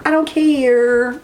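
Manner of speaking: slow, stretched and rising; stubborn drawn-out I don't care; drawn-out defiant. Shown as a demonstration. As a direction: linger on each word, daring anyone to object. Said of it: "but I don't care".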